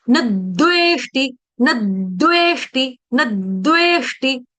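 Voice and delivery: "न द्वेष्टि" said slowly as nad-dveshti, closing on the द; light d touch. Shown as a demonstration. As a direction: press briefly on the द in "न द्वेष्टि", then release. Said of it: "हल्का सा द का touch देना". ("न द्वेष्टि" में न के बाद द का हल्का दबाव)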